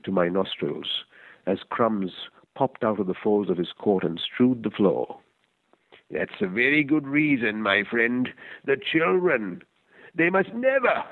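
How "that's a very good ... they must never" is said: put-on higher, the old man warning his friend; old man's voice. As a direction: press the point home as the old stranger.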